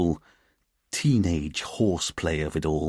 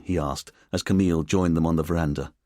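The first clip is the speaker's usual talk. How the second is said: usual speaking voice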